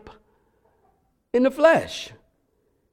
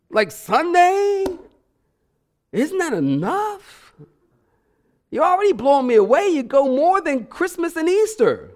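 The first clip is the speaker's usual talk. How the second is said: mocking outsider; 9 semitones higher than usual. Baffled unbeliever mocking a churchgoer, higher and incredulous. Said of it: "they don't understand why you go to church".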